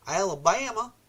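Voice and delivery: put-on a quick tongue-in-cheek stab at an Alabama accent; mock Alabama accent. Repeating a viewer's state in a playful mock Alabama accent. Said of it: "I don't even know if that's an Alabama accent".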